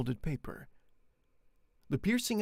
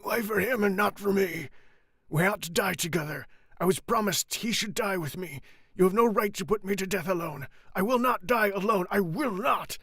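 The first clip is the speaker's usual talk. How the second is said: high, loud raving protest; desperate rage; 6 semitones higher, 5 dB louder than usual. Acted. Andrea raging at the guards, loud and desperate. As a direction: rage against dying alone.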